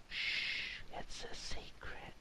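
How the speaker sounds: breathless hushed whisper. Pretend. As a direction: whisper it like a secret. Hushing a secret during quiz.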